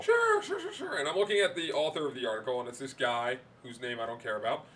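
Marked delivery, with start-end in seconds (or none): 0.0-1.0 high, sing-song sure sure; mock eager sures. Teasing agreement about the fake article, high sarcastic sures. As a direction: toss it off in a sarcastic squeak.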